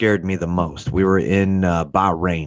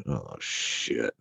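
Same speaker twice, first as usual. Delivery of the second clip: low, much softer muttered curse; whispered dread; 11 semitones lower, 12 dB quieter than usual. Retold or imitated. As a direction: breathe it out in dismay. Realizing he is in trouble.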